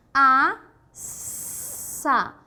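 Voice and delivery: "A, sa" with a long held hiss between vowels; single S sound. Shown as a demonstration. Teacher showing double S sounds single, long hissed s. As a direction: stretch the s in "A, sa" as one continuous sound. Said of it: "têm um som de S só".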